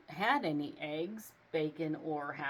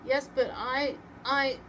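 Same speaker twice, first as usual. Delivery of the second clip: higher, louder flustered stammer; 6 semitones higher, 5 dB louder than usual. A narrator's reading. Santa stammering to Rump, higher and flustered. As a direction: stumble over the words, flustered. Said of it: "Santa stammered".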